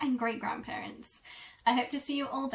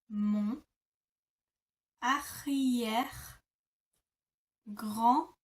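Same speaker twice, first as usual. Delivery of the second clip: mon grand-père split with long pauses; slow teaching demo; slow syllable demo; much slower than usual. Shown as a demonstration. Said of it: "it's mon arrière-grand-père".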